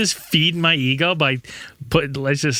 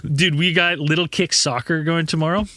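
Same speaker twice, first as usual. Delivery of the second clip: ordinary delivery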